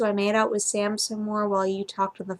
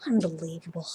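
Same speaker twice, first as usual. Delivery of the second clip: low, soft mutter to himself; 5 semitones lower, 7 dB quieter than usual. A narrator's reading. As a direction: grumble it under your breath. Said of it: "Blaine mutters to himself".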